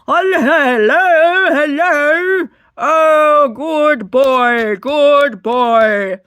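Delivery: loud, drawn-out hello to a dog; scary over-friendly greeting. Acted. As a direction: gush at full volume like you're towering over it. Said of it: "That would be so scary".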